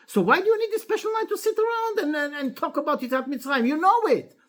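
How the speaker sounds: high-pitched, animated questioning; retold puzzled challenge. Clever son questions the special Seder night, retold puzzled challenge. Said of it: "What the Chacham is asking".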